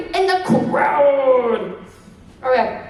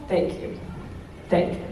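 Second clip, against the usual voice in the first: whispered thanks; 7 semitones lower, 9 dB quieter than usual. Shown as a demonstration. Demo bowing thanks to crowd.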